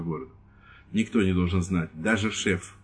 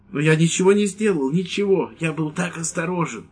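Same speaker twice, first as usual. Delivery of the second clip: anxious protest; 8 semitones higher than usual. Acted. Old priest protesting to the lieutenant, high and frightened. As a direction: plead innocence, scared.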